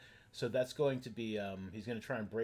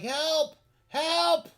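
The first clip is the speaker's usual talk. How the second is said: high, loud cry for rescue; yell for help; 12 dB louder than usual. Acted. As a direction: yell out like a pinned man calling for backup. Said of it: "he does yell for help".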